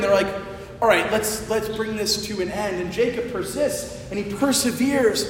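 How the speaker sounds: ordinary delivery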